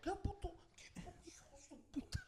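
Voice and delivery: whispered tough talk; hushed imitation of a weakened fighter; mock whispered taunt. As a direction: hiss the challenge under your breath. Same taunt, now whispered.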